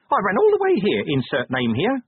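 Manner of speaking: high, loud little imp voice, proud; puffed-up tiny messenger. A narrator's reading. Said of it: "it said proudly".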